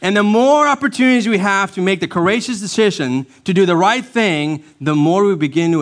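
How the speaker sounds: high, swelling pulpit delivery; impassioned preaching. Acted. Pastor urging congregation toward courageous decisions, impassioned preaching.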